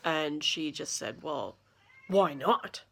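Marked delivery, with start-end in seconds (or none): 1.2-2.8 mock indignant, snooty retort; huffy and entitled, then rising on why not; uppity. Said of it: "she got all uppity about it".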